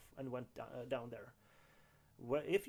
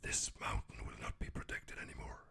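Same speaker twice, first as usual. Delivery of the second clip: deep, hissing whispered growl; hissing reptile growl; 5 dB quieter than usual. Acted. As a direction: rasp it out like a huge hidden serpent. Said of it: "And he would go".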